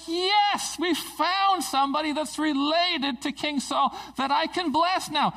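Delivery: high-pitched, elated exclamation; thrilled, acted-out celebration; excited. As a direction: burst out with delight at good news. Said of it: "He's excited about it".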